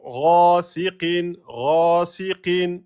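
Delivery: slow chanted "Ghasiqin" with a long first vowel; two-beat prolongation. Shown as a demonstration. Teacher demonstrating for students, "Ghasiqin" held two beats. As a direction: draw out the a of "Ghasiqin". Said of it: "Prolongation de temps".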